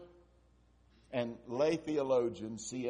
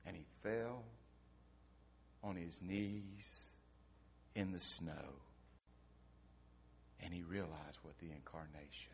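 hushed storytelling; 10 semitones lower, 16 dB quieter than usual. A narrator's reading. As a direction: draw them in quietly at the climax.

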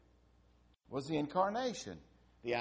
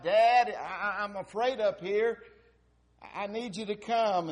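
put-on louder, higher call of a scared boy; child's frightened shout; 6 semitones higher, 6 dB louder than usual. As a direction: call down the stairs to Dad. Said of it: "he shouted out".

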